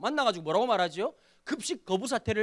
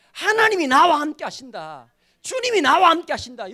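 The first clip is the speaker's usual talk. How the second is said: loud, raised proclamation of faith; impassioned declaration; 7 semitones higher, 10 dB louder than usual. Real, not acted. Pastor proclaiming God's presence to the congregation, impassioned. Preaches at full force.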